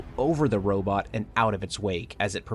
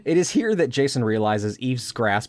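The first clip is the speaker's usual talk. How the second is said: normal talking voice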